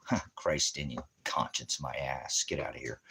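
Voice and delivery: normal talking voice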